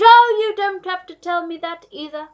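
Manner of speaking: high, loud interruption; crying out. A narrator's reading. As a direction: burst in excitedly at full voice. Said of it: "cried the Duke".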